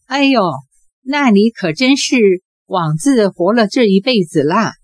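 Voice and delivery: ordinary delivery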